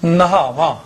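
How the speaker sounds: ਨਹਾਵਾ as na-haa-vaa with h sounded in full; full h syllable. Shown as a demonstration. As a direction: give ਨਹਾਵਾ its separate haa. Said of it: "ਨੰਨਾ ਹਾਹੇ ਕੰਨਾ".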